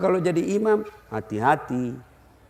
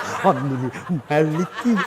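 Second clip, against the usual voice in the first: low, mock Fatihah recitation; exaggerated low chant, as if about to bite; ear-biting recitation; 7 semitones lower, 4 dB quieter than usual. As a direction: imitate someone reciting right into a neighbour's ear. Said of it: "kayak mau digigit kita punya telinga".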